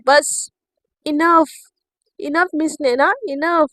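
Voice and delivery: firm angry stop, loud; cutting her off, fed up; enough, I've had it. Acted. Shivani snaps at Naina.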